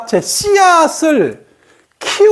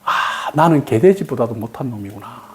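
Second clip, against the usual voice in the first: lower, softer, defeated self-talk; resigned child; 11 semitones lower, 6 dB quieter than usual. Retold or imitated. Teacher voices the cursed child's inner thought, lower and softer. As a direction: say it like the spell has sunk in. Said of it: "얘는 마법이 딱 걸려가지고".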